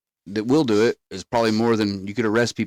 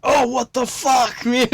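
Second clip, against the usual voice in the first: higher, slow, drawn-out outburst; disgusted shock; 3 dB louder, slower than usual. Retold or imitated. Reacting to puking in his own lap, shocked and slow. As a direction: recoil in disbelief.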